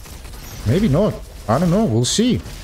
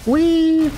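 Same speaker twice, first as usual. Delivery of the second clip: very high, sustained "whee"; playful whee. Real, not acted. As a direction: squeal a long high "whee". Flying about in the game.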